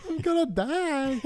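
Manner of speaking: high, thin imitation of panicked wailing; mock crying. Amused friend mimicking the kayaker's rescue plea, whiny panic. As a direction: replay a drowning man's plea in a high mock wail. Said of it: "He was crying like a bitch".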